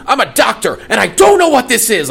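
high-pitched, hammed-up melodramatic cry; mock soap-opera scream. Director showing his actor the trailer line, mock soap-opera scream. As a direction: imitate an overwrought soap doctor screaming. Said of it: "stand next to this machine and scream".

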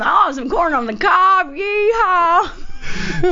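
slow, high hammed-up cowboy drawl; mock Texan cowboy, stretched out; cowboy accent. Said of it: "A cowboy".

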